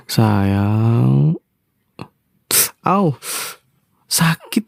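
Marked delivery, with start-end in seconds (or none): 2.8-4.6 high yelp of mock pain. Husband pinched by his wife, high pained yelp.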